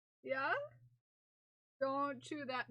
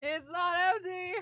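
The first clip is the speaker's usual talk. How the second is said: higher, louder playful fright; mock fear; 4 semitones higher, 5 dB louder than usual. Finding something inside the box, hammed-up scared squeal. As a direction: squeal that you're scared, half joking.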